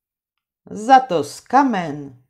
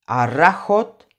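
plain reading voice

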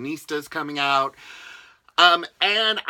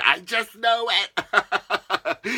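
slow, drawn out with a laugh; 4 semitones higher, much slower than usual. Real, not acted. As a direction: say it while laughing. Happy laugh closing line.

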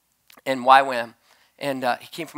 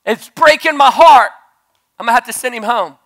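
heartbroken; 9 semitones higher, 12 dB louder than usual. Retold or imitated. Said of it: "and I say".

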